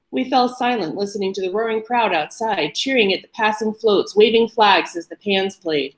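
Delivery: ordinary delivery, nothing marked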